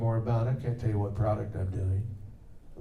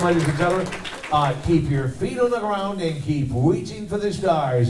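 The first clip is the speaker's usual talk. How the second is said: higher, louder radio host voice; Casey Kasem; 6 semitones higher, 4 dB louder than usual. Shown as a demonstration. Voice actor signs off to the crowd as the radio host, warm and booming. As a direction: sign off like a countdown DJ. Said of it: "in the words of Casey Kasem".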